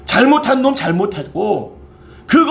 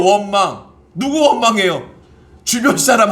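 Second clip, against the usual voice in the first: usual speaking voice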